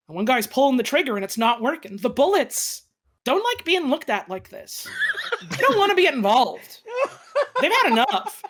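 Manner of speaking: ordinary talk, nothing marked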